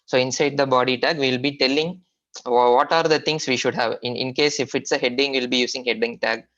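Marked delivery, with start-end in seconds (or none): none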